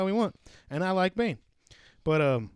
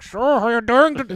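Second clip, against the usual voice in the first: much louder blasting version; loud mock line; 8 semitones higher, 13 dB louder than usual. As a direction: mimic the booming mix. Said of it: "the rest of the movie's like".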